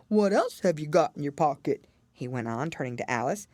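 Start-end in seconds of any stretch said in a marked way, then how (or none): 0.1-1.9 lightly voiced dodo, a touch grave; a hint of a solemn old bird asking. A narrator's reading. Dodo gravely questions Alice, lightly voiced grave bird. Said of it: "replied very gravely".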